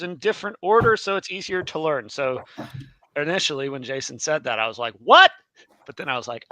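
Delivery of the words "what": high, loud, rising yelp of disbelief; incredulous reaction. Retold or imitated. Reacting to Jason's idea, loud incredulous outburst. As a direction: burst out with a startled question.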